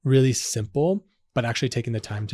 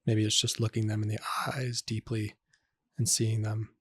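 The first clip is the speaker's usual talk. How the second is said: guided meditation; 4 dB quieter than usual. Acted. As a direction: ease the listener gently back into the room.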